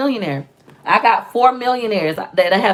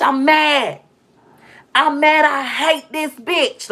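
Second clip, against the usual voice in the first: voicing anger; 6 semitones higher, much slower than usual. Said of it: "it make us mad".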